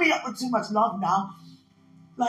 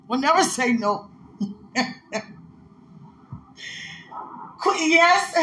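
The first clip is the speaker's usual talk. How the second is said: loud, higher, laughing bursts of yes; ecstatic shouting; 9 semitones higher, 8 dB louder than usual. Real, not acted. Overwhelmed by God's love, loud laughing shouts. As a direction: shout with giddy joy between laughs.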